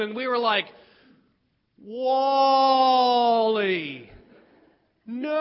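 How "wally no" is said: hugely stretched mock shout; slow-motion cry. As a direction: drag out each word like slowed-down film. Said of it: "slowed down into slow motion".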